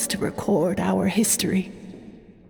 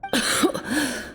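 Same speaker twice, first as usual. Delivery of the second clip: a frail, dry cough; weak cough; 5 semitones higher, 5 dB quieter than usual. Acted. Dying elder to his old friend, a frail cough. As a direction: cough weakly, like a sick old man.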